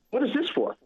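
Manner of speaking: high, puzzled question; a clerk caught off guard; surprised woman. Retold or imitated. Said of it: "she goes".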